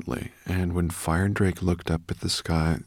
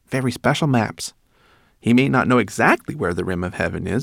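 higher, louder rat voice; busy little rat giving counsel; rat, advising; 5 semitones higher, 6 dB louder than usual. A narrator's reading. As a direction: advise firmly, pitched up.

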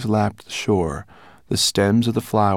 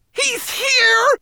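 high, sharp frightened cry; cry of terror. A narrator's reading. Twigleg cries out in terror in the plane, high and sharp. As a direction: blurt it out in sudden terror. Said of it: "he cried in terror".

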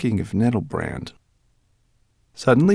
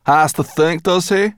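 higher, scornful echo; grumbling; 11 semitones higher, 5 dB louder than usual. A narrator's reading. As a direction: throw the words back crossly. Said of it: "she muttered".

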